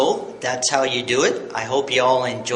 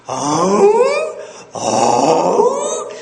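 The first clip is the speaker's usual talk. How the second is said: loud howl cracking upward; monster-to-high flip; 6 dB louder than usual. Shown as a demonstration. Yodel teacher showing beginners the flip, monster to high voice. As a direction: start as a monster, end in falsetto. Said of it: "monster voice and then end in your high voice".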